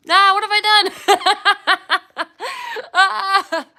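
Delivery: loud, slow laughing yelp; panicked laugh at a mishap. Real, not acted. Phone slipped while flipping camera.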